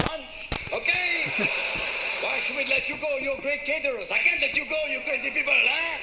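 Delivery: hammed-up French accent, lively pitch; mock French accent. Frenchman refusing to let the caterers go, French accent. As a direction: play a dismissive Frenchman with a thick accent. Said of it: "'cause the French would go".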